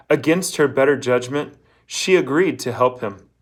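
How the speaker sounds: ordinary delivery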